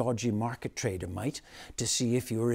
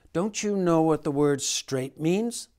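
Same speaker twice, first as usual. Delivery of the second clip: louder, higher scolding teacher; cross teacher; 5 semitones higher, 6 dB louder than usual. A narrator's reading. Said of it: "the teacher asked crossly".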